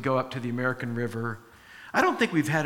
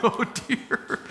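breathless laughing oh dear; shaky, laughing sigh of relief; 8 semitones higher than usual. Retold or imitated. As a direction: giggle it out in relief.

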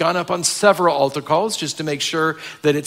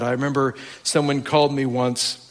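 ordinary talk, nothing marked

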